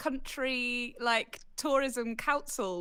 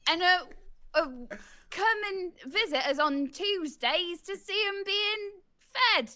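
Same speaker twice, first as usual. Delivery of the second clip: higher, slow Bristol tourism pitch; drawn-out, hammed-up Bristolian accent; exaggerated Bristolian; 6 semitones higher, slower than usual. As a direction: improvise an advert in broad West Country. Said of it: "with that voice, please".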